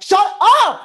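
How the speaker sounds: loud, high "Shut up!"; "Shut up!" shouted loud and high; full mock yell. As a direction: bark "Shut up!" hard. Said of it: "a complete asshole and be like".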